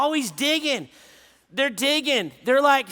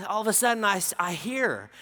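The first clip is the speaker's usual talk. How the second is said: ordinary talk, nothing marked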